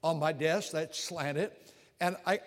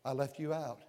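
retold humble prayer; 2 semitones lower, 5 dB quieter than usual. Preacher retelling asking God to find the paper, soft and humble. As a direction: pray it quietly and humbly. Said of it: "I'll say".

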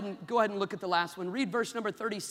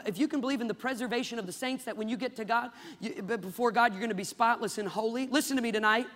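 ordinary talk

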